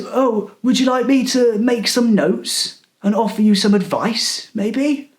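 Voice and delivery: a mock smug old casting director; hammed-up patronising offer; patronising old man. Retelling the cleaner's offer.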